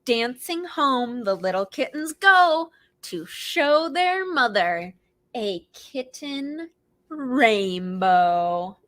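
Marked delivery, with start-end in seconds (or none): none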